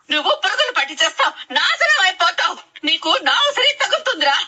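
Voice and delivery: scolding outburst with rising pitch; angry yelling. Acted. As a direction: curse your son in anguish. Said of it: "ఎందుకు అలా అరుస్తున్నావు?".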